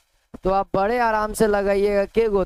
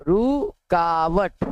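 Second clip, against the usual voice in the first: रुकावट stretched out very slowly, syllable by syllable; drawn out slowly; 2 semitones lower, much slower than usual. Shown as a demonstration. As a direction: dictate रुकावट very slowly so students can write it. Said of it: "व्यवधान मतलब रुकावट". (रुकावट को बहुत धीरे खींचकर बोलें)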